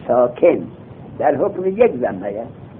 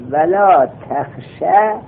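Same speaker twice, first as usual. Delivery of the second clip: slow dictation; much slower than usual. Shown as a demonstration. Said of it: "slow dictation of text".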